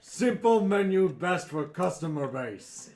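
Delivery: loud, blunt brute talk; gruff monster voice. Acted. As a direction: bellow it like a dim ogre. Other head speaks again.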